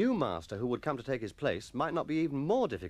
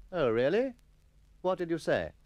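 curious colleague; 5 semitones higher, 4 dB louder than usual. Acted. Carter voice.

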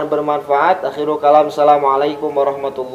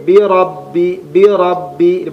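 "bi rabbi" with the b held and pressed; slower than usual. Shown as a demonstration. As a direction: stress the doubled b in "rabbi". ("bi rabbi" ditekan pada huruf ba)

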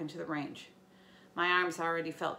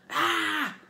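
loud, higher angry scream; angry yell of frustration; frustrated scream; 8 semitones higher, 8 dB louder than usual. Acted. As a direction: scream out in rage. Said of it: "Clarice screamed".

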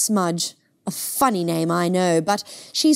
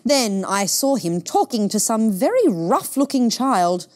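plain reading voice

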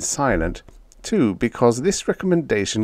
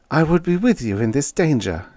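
higher, gently pleading voice; understated plea in a higher voice; 7 semitones higher than usual. A narrator's reading. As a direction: plead to come along, pitched up. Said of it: "she answered pleadingly".

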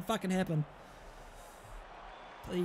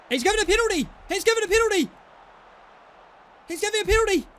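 high-pitched excited cry, falling at the end; stunned, high penalty call; excited disbelief; 9 semitones higher, 4 dB louder, much faster than usual. Real, not acted. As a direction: exclaim it high in real amazement. Penalty given in the match.

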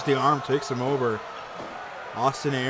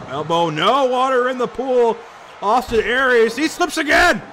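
excited; 8 semitones higher, 5 dB louder than usual. Real, not acted. Excited call of slip.

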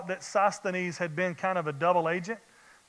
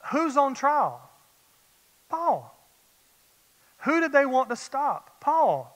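preaching at full force; 5 semitones higher than usual. Acted. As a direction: drive the questions home to the congregation. Preacher building call-and-response.

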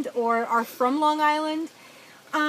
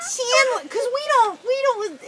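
put-on high, loud sitcom girlfriend voice; Janice impression; 12 semitones higher, 6 dB louder, slower than usual. Podcaster imitating Chandler's girlfriend, high and loud. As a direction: keep it pitched way up and loud. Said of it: "that might have sounded like Janice".

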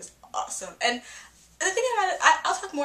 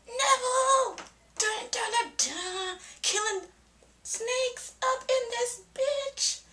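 much higher, hyped fanfare voice; hype cheer; much slower than usual. Retold or imitated. Fan hyping Neville killing snakes, much higher excited cheer. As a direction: cheer him on like an action hero. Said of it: "It's like".

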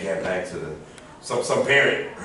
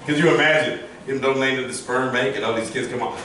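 ordinary talk

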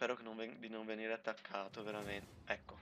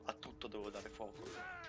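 ordinary talk, nothing marked

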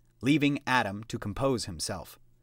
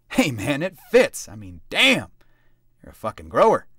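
louder, gleeful ribbing; loud teasing; 3 semitones higher, 7 dB louder than usual. A narrator's reading. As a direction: crow it for the table. Burgess teasing loudly.

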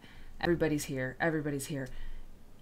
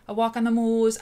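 a half-hearted Yorkshire accent, higher and louder; failed Yorkshire accent; 5 semitones higher, 7 dB louder than usual. Shown as a demonstration. Host trying a Yorkshire accent for her chat, mock Yorkshire. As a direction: have a go at Yorkshire on "moors" and give up. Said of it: "I can't do a Yorkshire accent".

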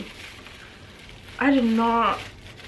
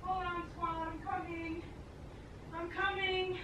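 far off; 8 semitones higher, 16 dB quieter than usual. Real, not acted. Calling from far away.

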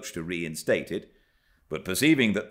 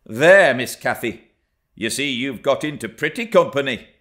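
louder, higher scolding; reproving nurse; 7 semitones higher, 9 dB louder than usual. A narrator's reading. Nurse interposing between Cathy and the lad, loud reproving voice. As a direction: break in loudly with reproof.

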